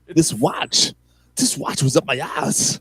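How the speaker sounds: slow, deadpan Walken-style delivery; Walken line. Retold or imitated. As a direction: drag it out with a heavy deadpan pause. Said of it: "I love the pause when he does that".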